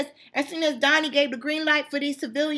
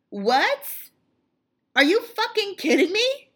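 much higher, loud incredulous outburst; outraged disbelief; 9 semitones higher, 4 dB louder than usual. Real, not acted. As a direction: blurt it out, floored by what you heard. Outraged at reporter's remark.

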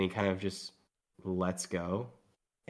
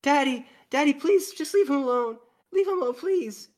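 put-on much higher, louder little child begging; pleading child; 8 dB louder than usual. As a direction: beg daddy to let go. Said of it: "kid runs up and he's like".

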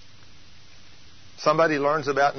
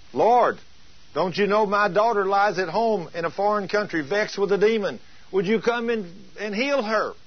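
imitated begging; 6 semitones higher than usual. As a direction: act out a mother pleading for her daughter. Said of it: "she came to the Lord begging".

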